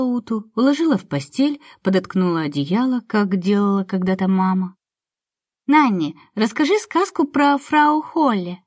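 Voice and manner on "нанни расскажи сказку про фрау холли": put-on little girl voice. Little girl asks for story.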